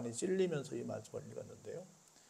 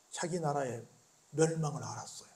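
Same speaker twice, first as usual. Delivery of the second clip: ordinary talk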